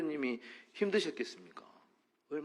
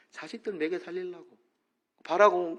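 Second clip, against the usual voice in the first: normal talking voice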